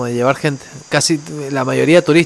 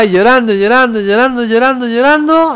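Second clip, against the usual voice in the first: climbing higher with each repetition; retold pleading; 5 semitones higher than usual. Recounting his haggling with the captain over the fare, rising pleading.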